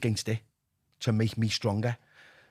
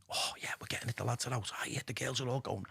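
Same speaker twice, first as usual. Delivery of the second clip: hushed tempting whisper; 9 dB quieter than usual. Retold or imitated. Addiction whispering temptation to him, hushed and secretive. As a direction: murmur it like a dealer luring you back.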